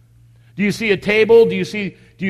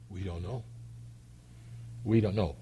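hushed, frightened denial, repeated; afraid murmur; 11 semitones lower, 16 dB quieter than usual. Acted. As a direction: mutter it low like scared parents. Fearful parents deny knowing.

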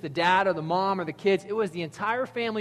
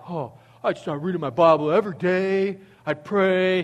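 imitating kids; 2 semitones higher, 6 dB louder than usual. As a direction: pipe up like an overkeen student. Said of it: "these kids are like".

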